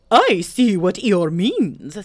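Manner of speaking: loud, slow, swooping owl voice; self-important Owl. Acted. Owl weighs in on Eeyore's complaint, loud and self-important. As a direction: pronounce your opinion grandly and loudly.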